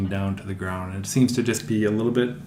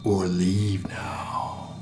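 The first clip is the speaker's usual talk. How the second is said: very slow alien whisper; whispered alien threat; 2 semitones lower, 6 dB quieter, much slower than usual. Acted. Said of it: "that loud alien voice you heard before booms out".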